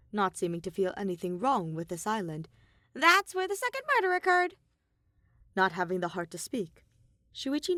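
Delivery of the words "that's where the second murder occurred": high, loud cartoon bear voice; louder, higher bratty bear mascot; Monokuma voice.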